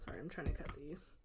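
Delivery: hushed aside while busy cutting; whispering. Real, not acted. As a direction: drop to a whisper, half to yourself. Quietly cutting candy on stream.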